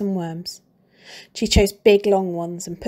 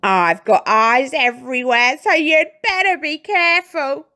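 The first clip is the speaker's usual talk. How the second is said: put-on gloating old hag; 6 semitones higher, 6 dB louder than usual. A narrator's reading. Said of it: "cackled Missus Twit".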